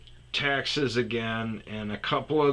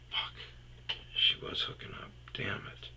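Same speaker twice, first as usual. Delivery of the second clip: cursing under the breath; very soft, voiceless grumble; whispered aside; 10 dB quieter than usual. Real, not acted. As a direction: whisper it to yourself in frustration. Muttered aside to himself mid-story.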